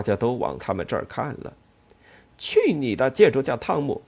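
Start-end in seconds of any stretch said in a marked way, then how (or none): 2.4-4.0 loud cursing at Tom; angry shout. Acted. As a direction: curse him out loud. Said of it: "他说".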